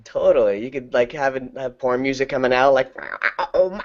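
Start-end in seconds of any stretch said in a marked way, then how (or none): none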